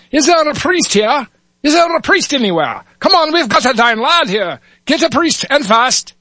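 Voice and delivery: high, urgent Irish call to the crowd; Irish cop calling. Acted. Officer Muldoon calls out to the crowd, urgent Irish accent. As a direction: shout for a priest in an Irish brogue. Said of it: "switch between dialects here".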